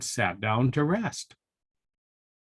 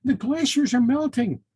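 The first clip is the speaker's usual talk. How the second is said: high, slightly louder chirp; 13 semitones higher, 6 dB louder than usual. A narrator's reading. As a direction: chirp the news like an alarmed little bird. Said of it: "chirped Tammy Ptarmigan".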